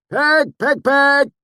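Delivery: loud call to a pig from far off. Acted. Swineherd calling his sow, loud far-off call. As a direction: call the pig home across the trees.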